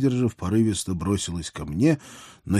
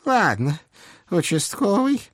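a hint of the old hag; curt old witch; 10 semitones higher than usual. A narrator's reading. Baba Yaga voiced by reader.

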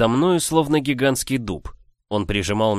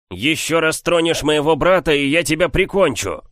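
furious threat; 7 semitones higher, 4 dB louder than usual. Acted. Hero threatens giant.

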